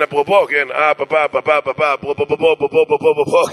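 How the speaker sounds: rapid repeated "פאפה", jammed on the P; comic stuck-record stutter on "פאפה". Acted. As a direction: stammer helplessly round "אפרופו". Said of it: "יש לי בעיה עם אות פ'".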